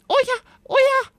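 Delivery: very high, fast squawk; squawking little dragon. A narrator's reading. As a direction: squeak it out like a tiny dragon. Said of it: "quäkte der kleine Drache".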